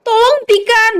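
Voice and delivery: high, desperate cry to stop; distressed high call; pleading shout. A narrator's reading. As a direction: beg the fighters to stop, voice pitched high. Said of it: "teriak Nara".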